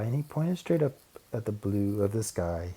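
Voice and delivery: plain reading voice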